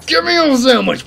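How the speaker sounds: high, loud shrieking yell; silly battle cry. Pretend. Gamer knifing an enemy, shrieking a silly battle cry. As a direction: scream it like a goofy war cry.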